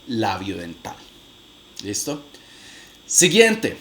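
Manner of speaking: usual speaking voice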